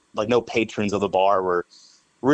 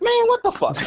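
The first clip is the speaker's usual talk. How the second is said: high, squeaky disbelief; pitched-way-up indignant cry; mock outrage. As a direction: shriek it in mock shock. Reacting to the insult.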